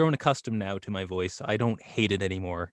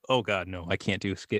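ordinary delivery

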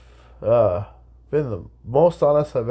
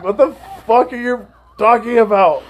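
incredulous quote; 10 semitones higher, 5 dB louder than usual. Retold or imitated. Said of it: "I'm like, I'm like".

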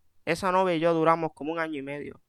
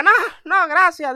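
high, louder panicked no thanks; panicked refusal; 11 semitones higher, 8 dB louder than usual. Retold or imitated. Terrified boyfriend refusing to meet the mother, high panic. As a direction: blurt out a scared refusal. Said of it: "Y yo".

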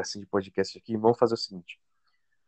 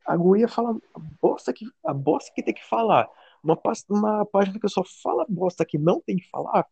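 indignant; 8 semitones higher than usual. Real, not acted. Indignant rant about being reported.

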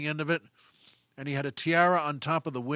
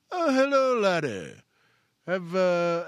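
Peter's theater voice; 2 semitones higher, 4 dB louder, slower than usual. Retold or imitated. Old actor greeting a young cast member, booming theater voice. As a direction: greet the boy like a grand old English actor. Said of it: "I'll never forget Peter's voice".

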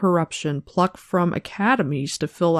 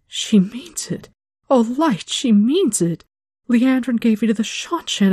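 frightened; 5 semitones higher than usual. A narrator's reading. Terrified apprentice realising the danger.

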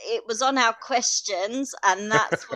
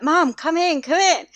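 put-on higher-pitched, eager child's call; kids calling mum; 8 semitones higher, 3 dB louder than usual. Kids urge mum into the sea, calling out.

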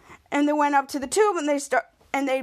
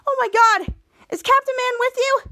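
high, louder fangirl gush; mock excitement; 10 semitones higher, 4 dB louder than usual. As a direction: imitate a thrilled fan, pitched up. Thrilled woman meets a superhero.

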